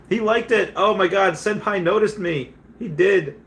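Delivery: high-pitched, hammed-up fan excitement; starstruck gushing. Streamer telling viewers his idol noticed him, gushing.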